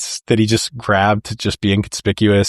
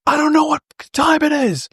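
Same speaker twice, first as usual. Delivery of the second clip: much higher, frantic and squeaky; frantic high cry. Retold or imitated. Bob panicking at the caller, frantic high yelp. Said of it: "freaking out".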